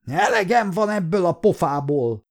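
angry yell. A narrator's reading. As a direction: read it as a narrator acting out a yell. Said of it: "ordította".

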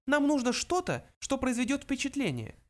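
high little mouse voice; high-pitched small creature. A narrator's reading. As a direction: state it as a tiny mouse. Mouse Benjy speaks.

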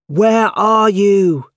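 slow, high, loud call. A narrator's reading. Spider-Man calling for a trapped person, slow high loud call. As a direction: call it through the smoke. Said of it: "he called".

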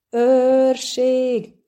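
loud, high call for the guards; yelling. A narrator's reading. Purple-clad official summoning the guards, yelling. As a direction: yell it out, pitched well up. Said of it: "üvöltött a bíbor fickó".

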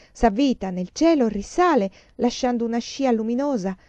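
usual speaking voice

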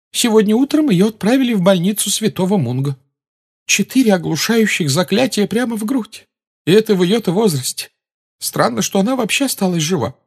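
a shaken, indignant nurse; matron's voice. A narrator's reading.

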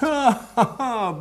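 a sudden high burst of laughter; hearty laugh. Acted. Lord laughs as he sees the solution. Lord hears the duchess's condition.

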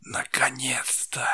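slow eerie unvoiced whisper; chilling whisper. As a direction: breathe it out like a killer. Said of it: "Леденящий душу шёпот произнёс".

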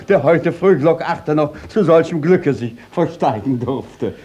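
normal talking voice

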